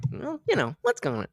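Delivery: soft, casual after the outburst; switched-off calm. Shown as a demonstration. Showing viewers how to switch off, calm and soft. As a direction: drop straight back to easy chat. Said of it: "turn it on and off like that".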